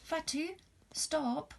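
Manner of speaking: high call. Acted. Missus Samate calls Fatu from under the tree, high call. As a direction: make her stop in her tracks.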